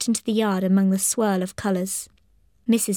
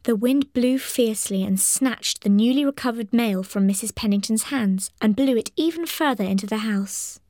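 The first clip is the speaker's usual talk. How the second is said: ordinary delivery, nothing marked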